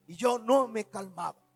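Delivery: ordinary talk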